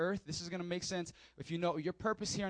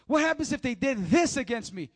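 higher, louder protest; indignant objection; 5 semitones higher, 7 dB louder than usual. Retold or imitated. Hurt church member protesting to the pastor, loudly indignant. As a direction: push back hard, wounded. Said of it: "voicing the hurt listener's objection".

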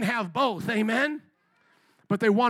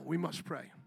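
sudden soft hush; 6 semitones lower, 10 dB quieter than usual. Acted. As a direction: drop to a solemn hush. Sudden hushed drop.

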